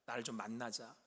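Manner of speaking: put-on soft whisper calling the children in; God's hushed gathering call; quiet, gentle call. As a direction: draw them near tenderly in a hush. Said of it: "조용히".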